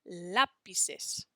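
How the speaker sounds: lápices with the first syllable stressed, LÁ-pices; lápices said with a strong LÁ; stress on the accent. Shown as a demonstration. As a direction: lean on the LÁ in lápices. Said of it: "that's where we put the emphasis".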